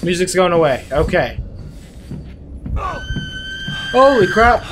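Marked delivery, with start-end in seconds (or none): 2.8-4.7 shrieking in real fright; startled high scream; scream of shock. Real, not acted. Sudden jump scare in horror game.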